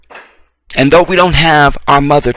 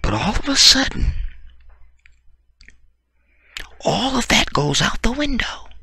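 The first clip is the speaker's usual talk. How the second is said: much higher and softer, mocking; 10 semitones higher, 9 dB quieter than usual. Real, not acted. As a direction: sing it up high, sneering. Sudden turn, mocking.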